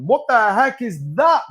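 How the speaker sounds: sudden high, loud, slow what-the-heck; surprised. Real, not acted. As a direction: caught off guard by something on screen. Spots something odd on TikTok.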